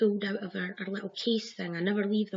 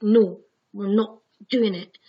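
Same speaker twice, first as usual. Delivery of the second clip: much louder, slow, firm refusal; 11 dB louder than usual. Retold or imitated. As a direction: put your foot down, loudly. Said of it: "I took control".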